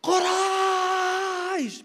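long, drawn-out high shout; shout at the top of his voice. Acted. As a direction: bellow it across the city. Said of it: "He yelled".

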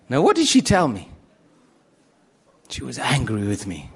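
ordinary talk, nothing marked

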